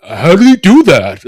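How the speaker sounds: loud, high, slow mock amazement; incredulous imitation. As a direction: imitate a gobsmacked video reactor. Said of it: "The incredulous imbeciles".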